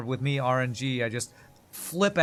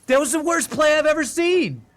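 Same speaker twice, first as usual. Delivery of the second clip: loud, high mock rage at a teammate; hammed-up furious yell, pitched way up; imitating an angry outburst; 9 dB louder than usual. Streamer flipping out at a bad teammate, mock yell. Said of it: "he'll flip out and be like".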